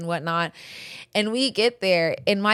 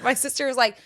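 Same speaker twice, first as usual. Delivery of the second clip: ordinary talk, nothing marked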